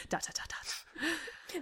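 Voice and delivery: very slow dramatic whisper; hushed, drawn-out suspense; whispered ellipsis. A narrator's reading. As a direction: whisper each dot as a cliffhanger. Reads out a trailing ellipsis.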